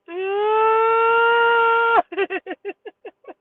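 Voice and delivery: long high wail breaking into stutters; terrified wail. Retold or imitated. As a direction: wail loud and high, then let it judder out. Acts out wailing in fright.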